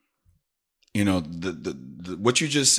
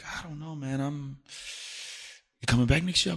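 weary colleague; 7 semitones higher, 6 dB quieter than usual. Retold or imitated. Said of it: "He was like".

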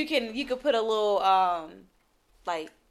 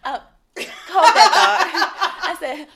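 slow, breaking into laughter; can't hold back the laugh mid-line; laughing through it; 6 semitones higher, 7 dB louder, slower than usual. Real, not acted. Laughs while faking phone call.

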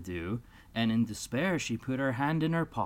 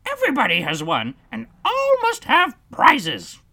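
high, loud grand verdict; a pompous bird decreeing; dodo voice; 12 dB louder than usual. Acted.

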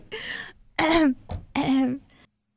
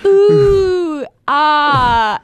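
mock awed ooh and ah; 3 semitones higher, 5 dB louder than usual. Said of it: "one second you're like".

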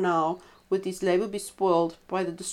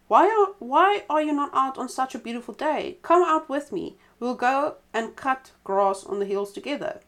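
calling out; 6 semitones higher than usual. A narrator's reading. Rabbit lures the badger out, raised cheery call. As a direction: call him out of the den cheerily. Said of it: "The rabbit called out".

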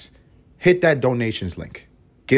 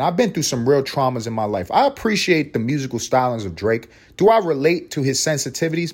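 ordinary talk, nothing marked

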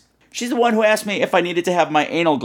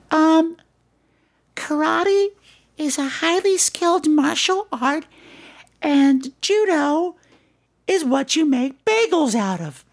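put-on much higher, slow, sing-song little girl; 14 semitones higher, slower than usual. Katie greets Mister Plummet, a high little-girl voice. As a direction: chirp it like a six-year-old girl.

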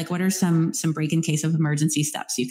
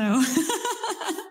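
short high laugh that trails off; 9 semitones higher than usual. Real, not acted. Host laughing after the ribeye story, high and brief. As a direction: laugh lightly at the steak story.

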